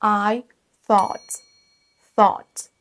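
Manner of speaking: thought as THAWT, tongue-tip TH at the start; TH sound. Shown as a demonstration. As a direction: model thought slowly, word by word. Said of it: "le son T H". (thought avec le son TH anglais)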